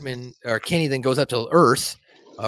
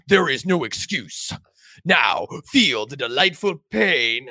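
slow, drawn-out mock menace; menacing Satan; slower than usual. Satan about to torture Kenny, slow mock menace. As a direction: savour each word like a cartoon devil.

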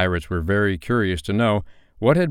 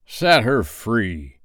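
slow, drawn-out captain's command; pirate captain hailing slowly; Hook imitation; 3 semitones higher, much slower than usual. A narrator's reading. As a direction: call the order out long and commanding. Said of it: "he now imitated the voice of Hook".

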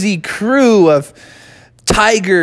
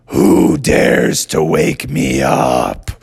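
very slow, low monster growl; angry woken bear; much slower than usual. Acted. Bear roused from hibernation threatens, slow low growl. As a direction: growl it out slowly like a menacing bear. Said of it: "And Brother Bear said".

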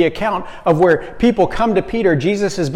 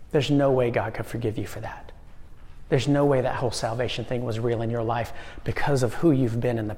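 softer, lower, insinuating voice of the heart; hushed inner voice; 4 semitones lower, 7 dB quieter than usual. Acted. Preacher voicing the heart's accusing lie, hushed. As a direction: murmur it like a doubting conscience.

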